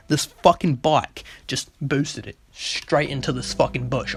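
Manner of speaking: ordinary talk, nothing marked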